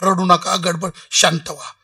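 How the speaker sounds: low, settling voice; imitated low-pitched reassurance; holy man's calming. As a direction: hush the wailing mourners with falling, measured phrases. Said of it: "Maharaj म्हटले".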